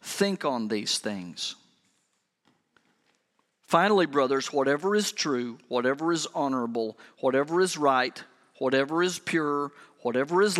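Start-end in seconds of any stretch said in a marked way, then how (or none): 0.0-1.5 slow, soft. Acted. Slow soft closing of reading. 3.7-7.0 scripture reading. Acted. Reading scripture slowly, softly.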